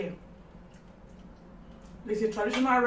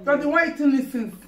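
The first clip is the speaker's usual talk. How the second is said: indignant accusation; 5 semitones higher, 7 dB louder, faster than usual. Acted. Accusing back.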